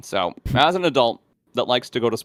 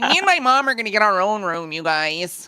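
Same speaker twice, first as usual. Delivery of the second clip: put-on high-pitched, louder young teen voice; fourteen-year-old impersonation; 11 semitones higher, 4 dB louder than usual. Teen bragging to teammates about him and his mom, excited high voice. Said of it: "impersonation of a fourteen-year-old".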